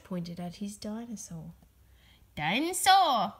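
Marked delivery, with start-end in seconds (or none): 2.3-3.3 growl. A narrator's reading. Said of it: "he growled".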